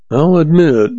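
high-pitched, meek admission. A narrator's reading. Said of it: "the sheriff said meekly".

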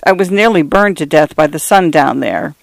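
plain reading voice

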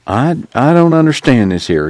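uneasy preacher struggling to understand; bewildered. A narrator's reading. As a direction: stumble in, troubled and puzzled.

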